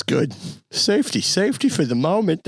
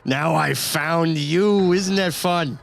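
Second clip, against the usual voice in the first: higher, mocking survivor taunting his old captor; taunting Eddie; 4 semitones higher than usual. Eddie taunts Doctor Walter, higher and mocking. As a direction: gloat at the doctor who hurt you. Player voices Eddie character.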